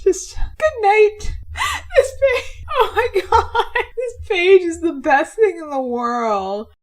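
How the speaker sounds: high, loud, words broken by laughter; laughing. Real, not acted. Creator cracking up over her favorite page, laughing. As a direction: gush through helpless laughter.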